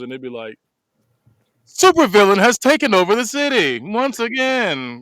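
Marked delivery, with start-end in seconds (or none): 1.7-5.0 loud, high, drawn-out news bulletin; slow, ringing breaking-news voice; mock newscaster. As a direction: announce it like a hammed-up TV anchor. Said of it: "you just watching the news".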